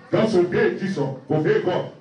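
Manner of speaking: ordinary delivery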